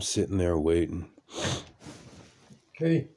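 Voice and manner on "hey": jumps up high in pitch, caught off guard; a real little start; startled hey. Real, not acted. Caught recording when his wife walks in, startled.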